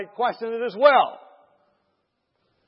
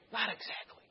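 very soft, hushed reply; barely voiced answer; whispering; 2 semitones higher, 15 dB quieter than usual. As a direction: confide it under your breath. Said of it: "whispering to each other again".

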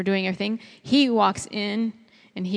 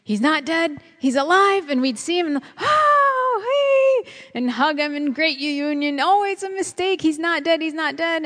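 much higher, louder, elated cries; joyful disbelief; 8 semitones higher, 3 dB louder than usual. Retold or imitated. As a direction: burst out with dream-joy at a reunion. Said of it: "I'd have this during my dream".

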